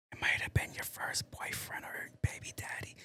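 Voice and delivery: soft unvoiced whisper of an aside. Real, not acted. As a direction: breathe it like a secret. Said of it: "Why am I whispering?".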